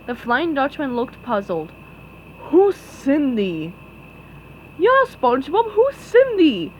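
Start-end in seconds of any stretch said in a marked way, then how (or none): 2.5-3.7 puzzled ghost pirate drawl. A narrator's reading. Flying Dutchman puzzled. 4.8-6.7 high, loud dopey confusion; confused Patrick. A narrator's reading.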